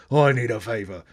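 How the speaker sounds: lightly put-on action-star voice; a hint of movie tough guy; Jason Statham. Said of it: "I'll stop with voice".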